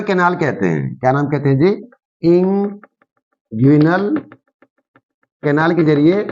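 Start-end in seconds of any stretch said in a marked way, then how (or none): 2.2-4.1 inguinal drawn out very slowly, ending on a rise; teacher slowly spelling out inguinal by sound; slow dictation. Shown as a demonstration. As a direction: stretch out inguinal syllable by syllable for note-takers. Teacher repeats term for students.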